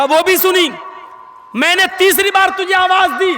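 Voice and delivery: retelling a stern master scolding. Retold or imitated. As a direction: press her with rising insistence. Voiced questioning, shout measured.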